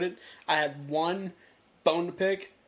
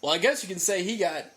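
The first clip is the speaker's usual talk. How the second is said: normal talking voice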